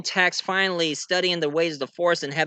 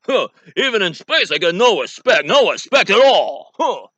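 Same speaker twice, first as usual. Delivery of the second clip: loud, high, aggrieved comic; hammed-up comedian's bluster; Rodney Dangerfield; 4 semitones higher, 7 dB louder than usual. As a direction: complain loudly like a put-upon stand-up. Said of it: "I just can't resist doing Rodney Dangerfield".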